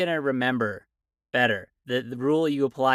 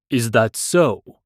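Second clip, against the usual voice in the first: stern mentor; 3 semitones lower, 7 dB louder, slower than usual. Acted. Stern mentor Dara speaks.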